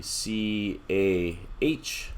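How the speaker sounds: S O H read letter by letter, very slowly; spelling out letters. Shown as a demonstration. Teacher slowly spelling the acronym letters for students. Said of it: "So you see here".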